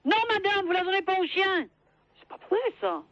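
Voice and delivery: normal talking voice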